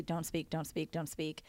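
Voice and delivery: quiet mental reminder to stay silent; inner self-talk. Retold or imitated. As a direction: murmur it to yourself like an inner voice. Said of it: "in my head say".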